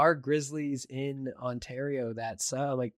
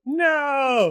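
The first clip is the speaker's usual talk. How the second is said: put-on childish outraged no; 11 semitones higher, 8 dB louder than usual. Child protesting boys can't marry.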